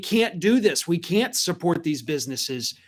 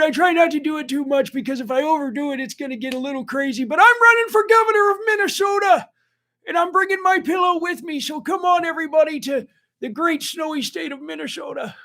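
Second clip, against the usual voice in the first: Mike Lindell impression; 12 semitones higher than usual. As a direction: hype it up like a pillow infomercial. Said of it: "Your Lindell impression is awesome".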